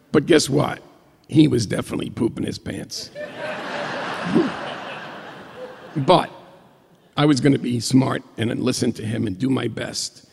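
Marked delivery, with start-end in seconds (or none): 3.1-5.0 a high giggling burst; high squealing laugh. Real, not acted. Laughing at his own story.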